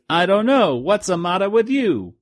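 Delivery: high, cheeky punchline delivery; prank-calling kid. Retold or imitated. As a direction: land the pun like a bratty toon. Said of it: "And he says".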